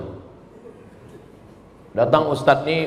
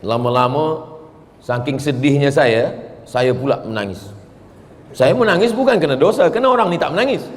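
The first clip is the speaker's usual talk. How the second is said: ordinary delivery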